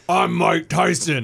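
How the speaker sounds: slow, loud, tough-guy Tyson growl; what you'd expect Tyson to sound like. Retold or imitated. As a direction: announce yourself slowly like a tough heavyweight. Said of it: "you expect him to be like".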